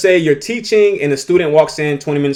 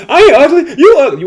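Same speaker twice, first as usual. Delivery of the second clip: mock comeback; 11 semitones higher, 7 dB louder than usual. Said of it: "what you gonna do".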